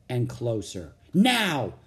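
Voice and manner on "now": high, loud urgent cry; sudden sharp shout. A narrator's reading. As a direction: shout the signal to go. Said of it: "shouted Annie".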